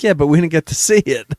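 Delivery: exasperated retort. Retold or imitated. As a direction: fire back, a bit put out and amused. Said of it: "And I'm like".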